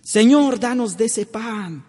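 high, loud eager request; listeners' request. Retold or imitated. Crowd asking Jesus for the bread, high eager plea. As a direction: ask for the bread, raising the voice. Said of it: "le pidieron los oyentes".

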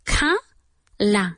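"Ca- la" split, both vowels open ah; two open a sounds. Shown as a demonstration. Teacher breaking "color" into syllables for students, open vowels. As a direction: say "Ca-" then "la" like kah-lah. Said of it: "Como si fueran dos As".

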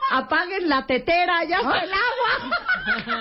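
high, slow, whistling kettle laugh; teakettle laugh imitation. Host mocks Tere's laugh as a kettle whistle. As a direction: mimic her laugh like a boiling kettle. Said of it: "Dolores dice".

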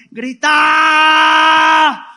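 huge high battle cry from the gut; passionate shout. Retold or imitated. The preacher acts out Joshua's fierce shout, full-throated. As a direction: roar it so they hear you across the walls. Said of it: "fue un grito de".

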